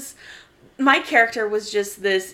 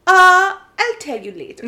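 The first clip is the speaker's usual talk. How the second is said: put-on German accent; 8 semitones higher, 9 dB louder than usual. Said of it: "he's like".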